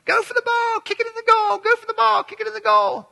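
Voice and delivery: shrieky high sideline cheering; loud, squeaky mock cheer on one high note; real high. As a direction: imitate relatives hollering in a very high voice. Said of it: "real high".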